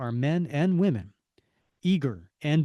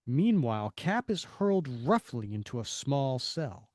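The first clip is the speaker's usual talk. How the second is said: ordinary delivery, nothing marked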